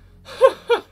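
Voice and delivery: sudden loud, high burst of laughter. Real, not acted. Fan laughing at a wild scene, loud and high.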